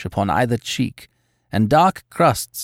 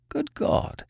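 horrified gasp; 6 dB quieter than usual. A narrator's reading. Watson horrified exclamation.

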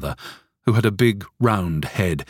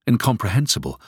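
usual speaking voice

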